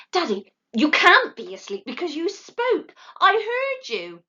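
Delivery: high, eager little owl voice; insistent owlet. A narrator's reading. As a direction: catch him out.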